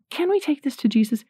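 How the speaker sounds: timid asking. Shown as a demonstration. Parent wrongly asking a child's permission, tentative and high. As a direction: ask it hesitantly, as if they might say no. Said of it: "you don't say".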